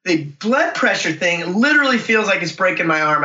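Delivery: usual speaking voice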